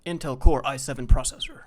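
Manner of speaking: loud ad-read voice with a weird S; loud mock tech-ad read; ad announcer's hissy S. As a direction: imitate an announcer, S sounds leaning to SH. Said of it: "That's how he does it".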